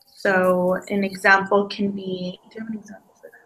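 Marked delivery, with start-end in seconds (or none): 2.5-3.3 whispered aside. Real, not acted. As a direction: voice dropping away into a whisper. Stuck, asks partner for an example.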